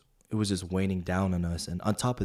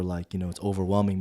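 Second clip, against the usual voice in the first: usual speaking voice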